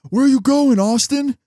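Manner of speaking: imitating a driver's loud, high-pitched call; retold yell. Driver yelling from the store doors after his escaping passenger. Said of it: "I hear this guy yell".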